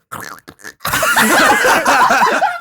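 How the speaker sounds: high, screechy goblin cackle; goblin noise. Acted. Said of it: "Is that goblin noise?".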